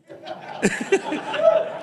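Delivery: a high, breathy burst of laughter. Real, not acted. Laughing at his own point.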